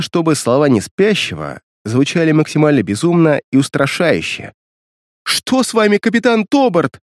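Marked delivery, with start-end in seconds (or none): 5.2-7.0 much higher, horrified question; terrified cry. Acted. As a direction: cry out in terror. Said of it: "в ужасе закричала Линет".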